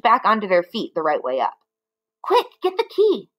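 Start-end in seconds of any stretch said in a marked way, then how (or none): 2.2-3.3 high urgent call. Acted. Mugglewump calling to the roly-poly bird, urgent. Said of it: "Mugglewump called out".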